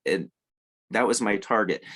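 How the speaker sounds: ordinary talk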